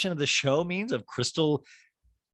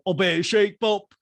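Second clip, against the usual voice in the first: high silly P K impression; 9 semitones higher than usual. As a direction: ham it up as a crooning man. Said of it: "P K's like".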